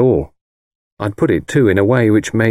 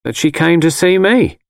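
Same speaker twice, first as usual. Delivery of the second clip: higher-voiced mother stating a fact; mother's matter-of-fact statement; 10 semitones higher than usual. A narrator's reading. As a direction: tell your son plainly, as his mother. Mother states it, son echoes.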